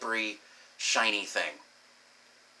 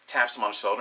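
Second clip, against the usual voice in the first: ordinary delivery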